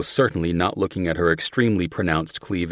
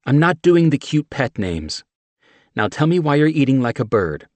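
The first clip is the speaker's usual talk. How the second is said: higher, louder annoyed woman; irritated; 8 semitones higher, 6 dB louder than usual. A narrator's reading. Mickey scolding John over pet names, irritated. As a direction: push back, fed up. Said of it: "more irritated".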